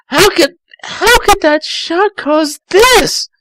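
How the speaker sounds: very high, slow, crying plea; hammed-up tearful whine; distressed cry. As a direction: wail it out in dismay. Distressed character thought.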